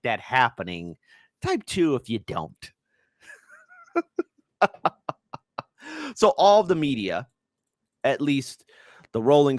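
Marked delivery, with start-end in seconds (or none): 4.6-5.1 brief chuckle; short laugh. Real, not acted. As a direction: let out a short, amused laugh. Laughs at his own poll.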